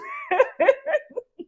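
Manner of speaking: high burst of laughter. Real, not acted. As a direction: laugh out loud at yourself. Laugh after punchline.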